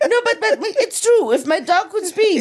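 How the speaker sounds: high-pitched, insisting through laughter; laughing. Real, not acted. Owner insisting the dog could speak, laughing. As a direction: keep protesting while cracking up.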